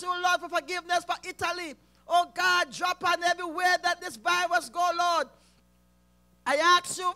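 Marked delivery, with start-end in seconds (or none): none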